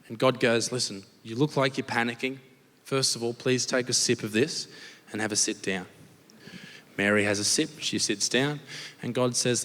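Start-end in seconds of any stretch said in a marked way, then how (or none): 0.7-5.9 low, measured reassurance; mock soothing. God calming panicking Mary with a drink, low and soothing. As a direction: settle them down in a low, smooth voice.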